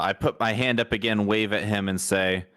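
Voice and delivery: usual speaking voice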